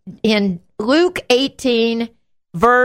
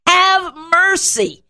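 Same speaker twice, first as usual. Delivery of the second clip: high, drawn-out plea for mercy; imitating a yelled appeal; acted yell; 5 semitones higher, slower than usual. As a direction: cry out like a desperate beggar, pitched up. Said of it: "that the blind man yells".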